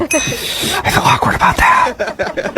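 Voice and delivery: slow, breath-only whisper with a laugh. Pretend. Prank caller confessing to the person fooled, whispering. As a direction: whisper it slowly, like a secret. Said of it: "Why are we whispering?".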